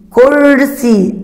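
كرسي said again with the ر rolled; teacher pointing out كرسي. Shown as a demonstration. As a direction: confirm the word after showing it. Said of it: "استمع".

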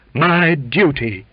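high, slow, loud declaration; impassioned, stretched words; ardent. A narrator's reading. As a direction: declare it with fervor, drawn out. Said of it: "said the cook with ardor".

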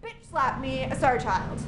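ordinary talk, nothing marked

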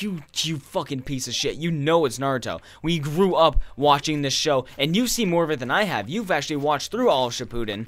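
pissed-off rant. Acted. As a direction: berate your brother in loud frustration. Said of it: "what really pisses me off".

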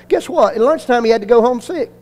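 ordinary delivery

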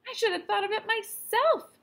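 mother scolding herself, high and anxious; mother's voice. A narrator's reading. Mother to husband about the aspirin, high and fretful. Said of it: "said Miss Gridley".

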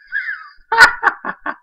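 high, loud, almost tearful cackle; laughing so hard it cracks into a shriek. Real, not acted. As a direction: break into a squealing laugh. Laughing at the accusation.

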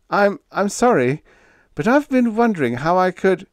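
high-pitched, flustered apology; faltering. A narrator's reading. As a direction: stammer sheepishly, then break off. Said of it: "he faltered".

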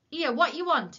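loud, high, angry challenge; angry cry. A narrator's reading. Shopkeeper spotting Willie by the flour sack, angrily. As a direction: cry out sharply at an intruder. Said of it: "he cried angrily".

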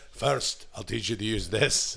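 lightly voiced movie-mentor impression; Uncle Angus from Braveheart. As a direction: give the lesson with a hint of film gravity. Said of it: "That would have made Braveheart".

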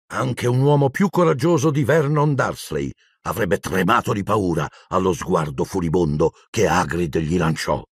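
usual speaking voice

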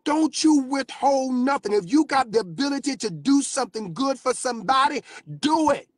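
high, loud exhortation; full-force preaching. Real, not acted. Preacher commanding the congregation, high and loud. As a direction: drive the command home to the church.

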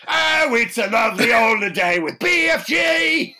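high, loud hammed-up Cockney; terrible Cockney accent. Co-host defying plea to stop, terrible shouted Cockney. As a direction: belt out a badly faked Cockney. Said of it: "one of the worst Cockneys".

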